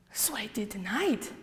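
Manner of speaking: whispered, breathless confession. Retold or imitated. Confessing her sins to her sister, in a whisper. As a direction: let the confession sink to a whisper.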